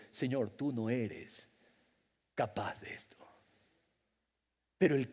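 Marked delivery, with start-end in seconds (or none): 0.1-3.2 hushed doubting prayer. Acted.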